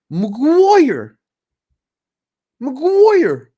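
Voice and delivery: high, loud, hammed-up Irish voice; attempted Irish accent. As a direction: belt out the name in a mock Irish accent. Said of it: "this accent cannot imitate an Irish accent".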